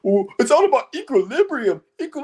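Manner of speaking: mock spiritual guru voice; mocking wannabe guru. Mocking wannabe deep people.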